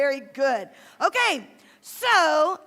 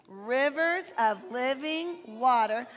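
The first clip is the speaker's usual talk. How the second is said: slow recitation; 3 semitones lower, much slower than usual. Shown as a demonstration. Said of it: "Can you say that with me?".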